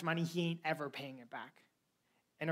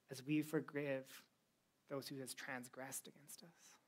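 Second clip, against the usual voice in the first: recited prayer; 3 semitones lower, 5 dB quieter than usual. Acted.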